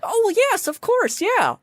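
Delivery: very high, chirpy agreement; perky sphinx. Acted. Sphinx telling players all may answer, high and perky. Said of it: "goes back to a perky self".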